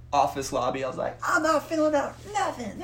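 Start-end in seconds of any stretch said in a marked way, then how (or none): 1.3-2.8 very high, hysterical mock refusal; freaking out. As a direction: squeal it like a tantrum. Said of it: "I'm, like, freaking out".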